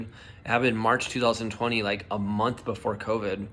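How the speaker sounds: ordinary talk, nothing marked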